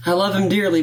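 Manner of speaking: ordinary talk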